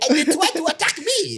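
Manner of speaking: imitating a high-pitched Haitian man; Haitian accent. Quoting a viral video to co-hosts, high mock Haitian voice. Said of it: "Haitian guy waiting for the bus".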